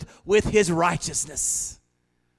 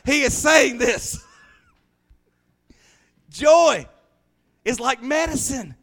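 higher, louder, slow preaching cry; preaching shout; 7 semitones higher, 8 dB louder, slower than usual. Real, not acted. Preacher shouting to the congregation, full force. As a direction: lift the room with it. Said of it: "preaching at full force".

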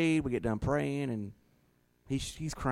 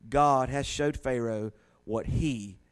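raised, measured Bible reading voice; scripture reading; 3 semitones higher than usual. Acted. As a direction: read the verse out like a preacher. Reads Genesis aloud.

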